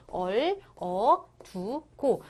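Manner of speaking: 얼, 어, 두, 고 with a pause after each; syllable-by-syllable reading. Shown as a demonstration. Teacher repeats the reading for students, 얼, 어, 두, 고 separated. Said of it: "이렇게 읽으시면 되는 거야".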